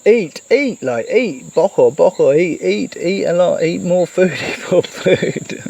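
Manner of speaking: playful grandma pestering, breaking into a laugh; doting grandmother urging food. Retold or imitated. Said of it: "she would always tell me".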